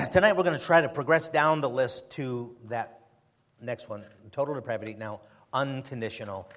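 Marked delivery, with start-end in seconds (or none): 0.1-0.4 coughing fit. Real, not acted. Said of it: "Sorry about the coughing today".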